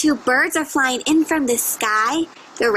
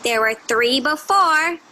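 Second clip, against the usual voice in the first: ordinary delivery, nothing marked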